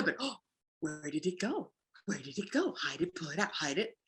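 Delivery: quietly teasing the dog with a hidden toy; hushed teasing. Shown as a demonstration. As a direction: hush it like a secret game.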